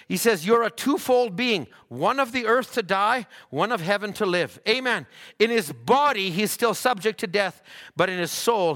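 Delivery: high, driving sermon delivery, easing at phrase ends; forceful preaching. Acted. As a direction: drive each point home to the congregation.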